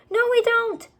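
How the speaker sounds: very high, loud shout; boy shouting to stop the wish. A narrator's reading. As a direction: shout her down in panic. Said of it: "shouted Jack".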